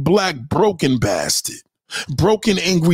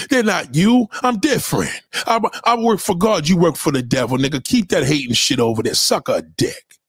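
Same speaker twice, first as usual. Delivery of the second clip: normal talking voice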